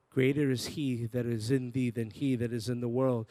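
ordinary delivery

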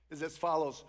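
usual speaking voice

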